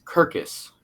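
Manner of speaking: Kirkus with a hard K at the start; imitating the wrong Kirkus; mispronounced circus. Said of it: "You pronounce circus".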